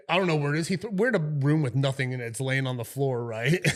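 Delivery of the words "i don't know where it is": louder, higher fake-innocent protest; mock innocent. Sergeant to the cop, a louder mock-innocent denial. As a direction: play dumb loudly about the license. Sergeant quoted playing dumb, replayed.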